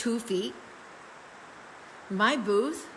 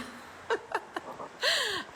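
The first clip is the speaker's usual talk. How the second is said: long, high-pitched giggle; real laugh; 12 semitones higher than usual. Real, not acted. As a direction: break into high, helpless giggles. Laughs at being new.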